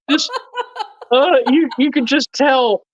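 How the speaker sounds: high, amused, getting over a laugh; real laughter lifting the voice. Real, not acted. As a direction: talk through the tail of a laugh. Cracking up after imitating the yell.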